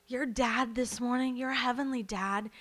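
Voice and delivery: usual speaking voice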